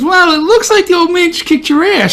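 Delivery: mock wife voice. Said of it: "She goes".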